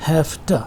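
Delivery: "have to" run together as "hafta"; f in place of v in "have", short "ta" for "to"; voiced to voiceless. Shown as a demonstration. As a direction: say "have to" as a single word, "hafta". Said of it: "फ हो गया है".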